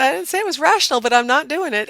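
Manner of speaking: emphatic mock-indignant refusal; insistent retort. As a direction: stubbornly overrule him, voice up high. Wife insists against husband's logic.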